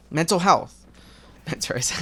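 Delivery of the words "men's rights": laughing. Real, not acted. Laughing at own word slip.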